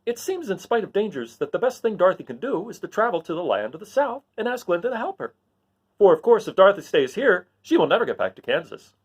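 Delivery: straw man deciding; Scarecrow. Acted. Scarecrow urging Dorothy to go south. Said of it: "the Scarecrow said".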